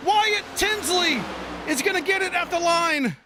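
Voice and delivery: excited commentator calling a photo finish. Real, not acted. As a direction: build the call as they cross the line.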